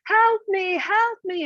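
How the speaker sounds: high, loud cry for help; yelling for help. Acted. Sky Woman falling through the sky, high loud yell. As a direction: call out in panic as she falls. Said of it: "she was yelling out".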